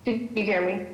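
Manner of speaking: words dropping out over the phone; bad connection, voice breaking up; clipped phone line. Real, not acted. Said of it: "You're breaking up a little bit".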